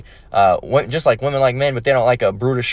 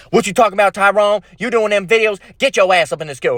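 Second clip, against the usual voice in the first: put-on higher, bossy girlfriend calling her man in; 8 semitones higher than usual. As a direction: scold him like a jealous girlfriend. Girlfriend scolding Tyrone in skit.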